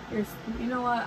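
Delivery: normal talking voice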